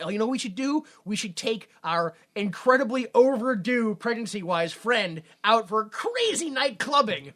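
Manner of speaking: mock enthusiasm. The girls deciding to take pregnant friend clubbing, mock-excited. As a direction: imitate girls hatching a bright idea. Voicing the girls' bright idea.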